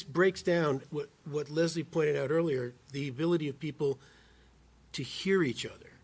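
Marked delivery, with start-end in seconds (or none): none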